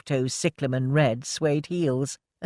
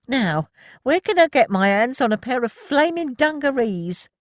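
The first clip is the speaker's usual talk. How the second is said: high, loud cheeky question; 10 semitones higher, 4 dB louder than usual. A narrator's reading. Milly joking to the cheering crowd, high and cheeky. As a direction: toss it out with a grin.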